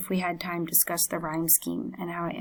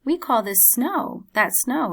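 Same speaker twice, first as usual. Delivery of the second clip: higher, slow and friendly man's voice; helping man; 5 semitones higher, 5 dB louder than usual. Acted. Airport helper greets the narrator, lightly voiced friendly man. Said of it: "he says".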